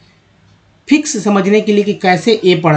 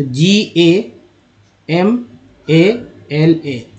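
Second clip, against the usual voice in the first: letter by letter, very slow spelling; spelled out slowly; much slower than usual. Shown as a demonstration. Teacher spelling गमला for pupils, very slow. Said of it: "तभी ये गमला लिखा गया है".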